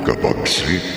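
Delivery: ordinary delivery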